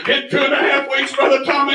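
high revival cadence; impassioned pulpit shout; fervent preaching. Real, not acted. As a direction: proclaim the miracle to the congregation. Preaches at full force.

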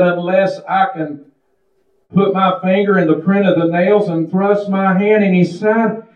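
lightly voiced doubting disciple; doubting Thomas. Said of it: "He said".

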